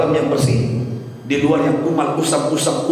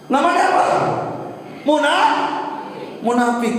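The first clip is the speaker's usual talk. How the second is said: impassioned preaching; 12 semitones higher than usual. Real, not acted. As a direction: preach it out, pitched high. Preaches at raised pitch.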